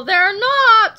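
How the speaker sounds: high, loud drawn-out objection; loud contradiction. A narrator's reading. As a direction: cut in and contradict everyone loudly. Tattletale loudly contradicts the others.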